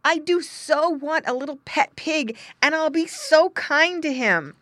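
put-on high, loud child's pleading; earnest boy. Boy wanting a pet pig, pleading. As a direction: promise eagerly like a small boy.

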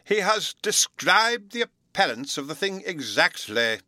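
high, loud, eager praise; with enthusiasm. A narrator's reading. Professor praising Bruno to the room with enthusiasm. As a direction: exclaim it with delight. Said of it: "the professor exclaimed with enthusiasm".

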